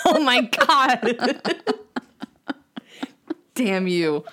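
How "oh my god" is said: laughing out loud; breaking into laughter. Real, not acted. Laughing reaction to joke.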